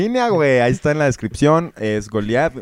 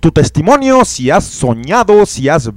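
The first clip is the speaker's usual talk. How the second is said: loud, slow radio plug; announcer; 6 semitones higher, 12 dB louder than usual. Acted. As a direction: read it out like a show announcement. Reads show contact plug.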